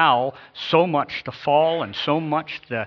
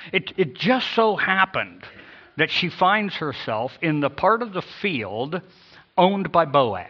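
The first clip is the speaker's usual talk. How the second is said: higher, slow, knowing lilt; emphatic make-believe happenstance; mock coincidence; 5 semitones higher, 4 dB louder than usual. Shown as a demonstration. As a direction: stretch it out as if amazed by chance. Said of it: "That's how this is worded".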